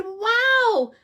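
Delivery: high, amazed wow; delighted mother marvelling; amazed mom. Acted. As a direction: gush like a proud mom. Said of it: "She said".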